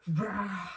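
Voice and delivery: a short burst of imitated rushing water; gush noise. Telling her story, she mimics the water gushing out. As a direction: make the splash of the water with your mouth.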